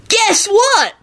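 very high, drawn-out squeal; ecstatic. A narrator's reading. Kenny about to share great news, ecstatic.

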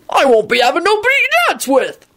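high, rolling giant's bellow; rolling Hagrid voice. Acted. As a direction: boom it out like a rowdy giant. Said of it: "he rolled at Quentin".

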